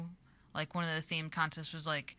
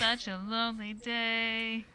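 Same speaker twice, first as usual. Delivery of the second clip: mock lament; 4 semitones higher, 3 dB louder, slower than usual. Mock lament over game title.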